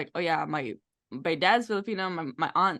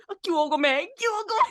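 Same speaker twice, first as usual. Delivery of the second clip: climbing high pleading; Cantonese mock plea; 12 semitones higher, 3 dB louder, much faster than usual. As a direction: push higher on the repeat.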